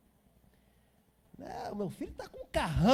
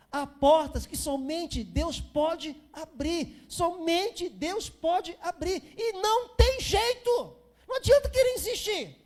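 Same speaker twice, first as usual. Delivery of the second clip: much higher and louder, slow and insistent; climactic preaching; 8 semitones higher, 7 dB louder, slower than usual. Acted. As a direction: build to a full-force pulpit cry. Sermon climax about doors. (pregação no clímax, bem mais alta e forte)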